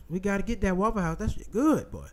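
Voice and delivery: ordinary talk, nothing marked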